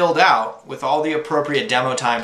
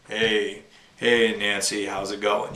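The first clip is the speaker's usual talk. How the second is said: lower, softer smooth radio-host voice; deep radio voice; 3 semitones lower, 3 dB quieter, slower than usual. Shown as a demonstration. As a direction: play the suave radio DJ dropping low. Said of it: "like, a radio, radio, uh, voice like".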